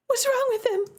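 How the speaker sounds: high, alarmed question; frightened plea. Acted. Sister holding her limp brother, high frightened question. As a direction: beg to know.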